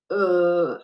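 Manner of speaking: drawn-out Arabic hesitation uh; Arabic-style filler uh, held; arabic accent. Shown as a demonstration. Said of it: "every time we hear them say".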